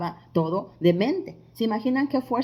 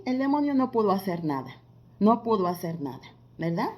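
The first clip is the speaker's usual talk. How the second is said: ordinary talk, nothing marked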